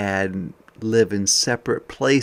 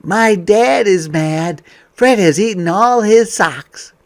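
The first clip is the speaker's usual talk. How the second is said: higher and louder, building trouble; dramatic storytime; 10 semitones higher, 11 dB louder than usual. Acted. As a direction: read it like the plot thickens. Reading picture book aloud.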